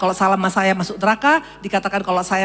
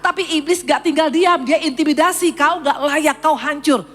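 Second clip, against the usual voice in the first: higher, slow, impassioned sermon voice; fervent preaching; 10 semitones higher, 3 dB louder, slower than usual. Acted. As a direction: preach it with rising passion. Preaching with rising fervour.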